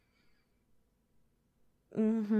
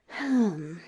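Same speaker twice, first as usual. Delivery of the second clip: ordinary delivery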